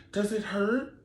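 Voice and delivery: high, timid question; imitating a clueless young bride; lightly voiced innocent girl asking; naive bride. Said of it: "So she's like".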